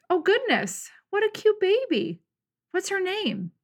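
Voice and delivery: a hint of a sweet old woman gushing. Narrator voices sweet old woman.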